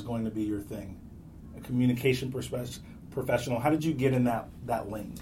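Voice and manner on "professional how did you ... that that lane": usual speaking voice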